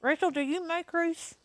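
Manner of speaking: talking with food in mouth; mouth full. Real, not acted. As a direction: speak round a mouthful. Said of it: "Sorry, I have something in my mouth".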